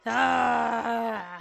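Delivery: theatrical wailing sigh; long held vocal release; loud drawn-out "aah". Acted. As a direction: let out a long, loud acted cry. Acted cry after the laugh.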